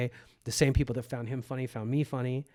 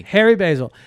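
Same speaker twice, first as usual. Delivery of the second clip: shout-out; 8 semitones higher, 13 dB louder than usual. Real, not acted. Shouting out Harry Basil.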